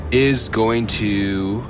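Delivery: usual speaking voice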